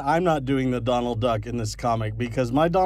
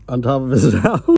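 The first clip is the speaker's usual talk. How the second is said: ordinary delivery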